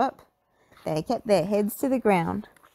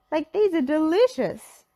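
Shaggy voice; 9 semitones higher, 4 dB louder than usual. A narrator's reading. Shaggy tasting the veggie pie, high and delighted. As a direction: savour it.

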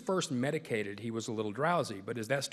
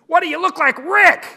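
high, very loud holler across a ballfield; yelled heckle; 17 dB louder than usual. Retold or imitated. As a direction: yell it out from the dugout at full volume. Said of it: "George gets up and yells".

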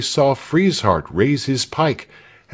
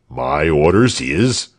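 low, slow official voice, lightly put on; stolid soldier; 6 semitones lower than usual. A narrator's reading. Frieshardt explains his orders, mildly pompous low voice. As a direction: lightly voice a dutiful sentry.

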